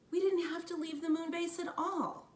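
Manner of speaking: a hint of a young girl; lightly voiced girl. A narrator's reading. Annie telling Jack they never had to leave, eager.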